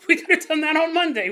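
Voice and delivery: high, loud, laughing through the line. Retold or imitated. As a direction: crack up as you say "Monday". Dad realizing, laughing.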